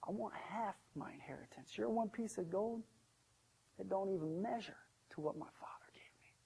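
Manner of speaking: soft, subdued and regretful; quiet confession. Acted. Young son tells the servant his story, quietly ashamed. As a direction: confess it softly, without pride.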